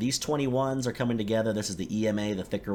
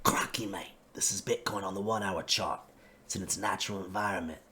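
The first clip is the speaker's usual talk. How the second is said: hushed Australian wildlife presenter; Steve Irwin impression; 4 dB quieter than usual. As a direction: whisper like a croc hunter in the bush. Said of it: "Sorry, terrible impression".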